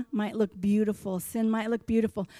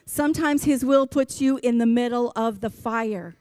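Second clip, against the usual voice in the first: usual speaking voice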